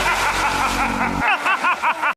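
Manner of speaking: a burst of real high laughter. Real, not acted. Laughing at the video.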